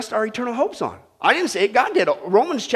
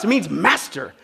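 ordinary delivery

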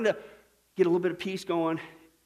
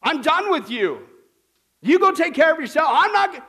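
fed-up, louder voicing of someone cutting a person off; mock cut-off rant; 8 semitones higher, 9 dB louder than usual. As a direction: play the exasperated person slamming the door.

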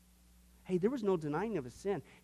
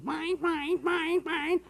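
much higher, squawky bird chant of mine; cartoon seagull squawk; 10 semitones higher, much slower than usual. Preacher imitating the cartoon birds, squawking mine. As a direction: squawk it like greedy cartoon gulls. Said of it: "like those birds on that cartoon".

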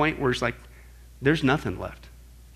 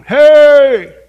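very loud, high hailing shout; megaphone-like shout; 10 semitones higher, 17 dB louder than usual. Acted. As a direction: bellow like a human megaphone. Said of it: "It's a megaphone".

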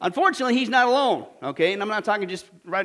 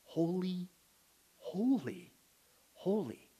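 much softer and lower, almost breathed; hushed, awed, much softer; hushed reverence; 4 semitones lower, 13 dB quieter, much slower than usual. Real, not acted. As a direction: say it in awe, barely above a hush. Thrice-repeated holy, reverent.

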